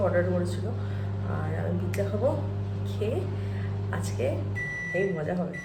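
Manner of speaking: soft, low late-night talk to the camera; hushed night chat. Real, not acted. Mother softly telling viewers about their evening plans.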